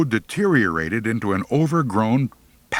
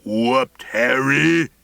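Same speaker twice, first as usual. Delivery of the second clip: booming; 5 semitones higher, 4 dB louder, much slower than usual. Acted. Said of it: "Scritch boomed".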